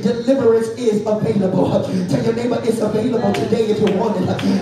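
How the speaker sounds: full-force sermon delivery, driving phrase by phrase; preaching. Real, not acted. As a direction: preach hard to the congregation, punching each phrase.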